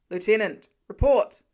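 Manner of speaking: radio voice calling for a report, loud. Acted. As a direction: demand a report urgently. Radio call in emergency.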